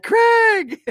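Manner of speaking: high shouted call of a name. Retold or imitated. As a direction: call his name across the crowd. Calling friend across a crowd.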